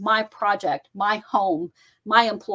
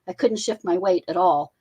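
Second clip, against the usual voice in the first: ordinary delivery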